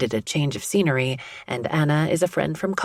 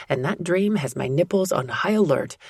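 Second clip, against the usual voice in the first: usual speaking voice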